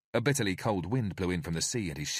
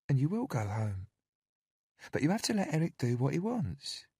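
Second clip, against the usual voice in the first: high, sugary caretaker voice; falsely sweet and cajoling; sweet coaxing woman; 7 semitones higher than usual. A narrator's reading. Narrator voices Candy.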